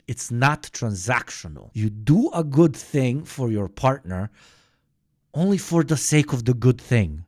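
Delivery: ordinary talk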